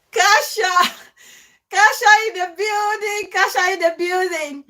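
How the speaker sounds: high, loud hype call; excited welcome shout. Real, not acted. Host welcoming her Polish friend to the live, shouting excitedly.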